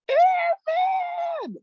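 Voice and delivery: very high, loud drawn-out squeal; imitated high scream. Gassed trainee struggling with creed, mock scream.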